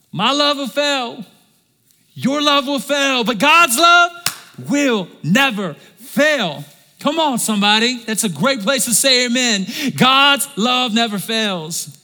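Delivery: loud, high, drawn-out preaching; fervent pulpit proclamation, slow and loud; preaching at full force. Acted. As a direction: build it up and hammer it home. Preaching climax on God's love.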